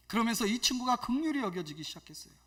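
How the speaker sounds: ordinary delivery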